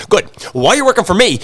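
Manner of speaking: high, loud, emphatic boss; grandfather laying down orders. Retold or imitated. Grandfather to delivery guy working for him, laying down orders. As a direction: imitate a boss seizing on the admission. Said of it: "He goes".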